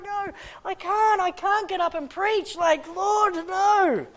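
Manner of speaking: high, loud pleading panic; panicked. Retold or imitated. The preacher begging God before the Sunday sermon, high panic.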